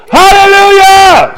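high, full-voiced praise shout; shouted church hallelujah. Shown as a demonstration. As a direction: belt it out, stretched long. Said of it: "Start shouting".

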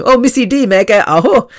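high, excited greeting breaking into laughter; playful impression of a funny guy's entrance; Ranj Rekhi. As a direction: burst in with a big, high-pitched hello. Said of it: "he just walked in going".

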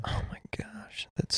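hushed, whispered dismay; appalled whisper. Real, not acted. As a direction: whisper it, shaken by the terrible news. Appalled by fatal crash details.

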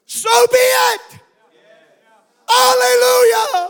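exultant high shout; shouted amen. Acted. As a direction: cry it out in worship.

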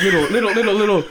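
high, light little plops, amused; imitated soft plopping. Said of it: "That's what it sounded like".

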